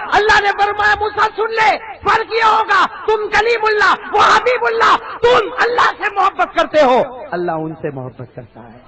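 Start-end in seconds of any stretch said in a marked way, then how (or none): 0.1-7.0 loud, high preaching voice; booming sermon. Acted. Preacher booming to the congregation at full force. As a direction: preach it out to the crowd.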